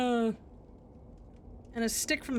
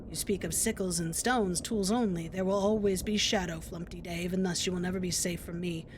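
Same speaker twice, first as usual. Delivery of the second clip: ominous villain; 7 semitones lower than usual. Acted. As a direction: threaten from the shadows. Scarecrow villain threatens.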